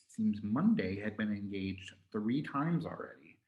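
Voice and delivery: ordinary delivery, nothing marked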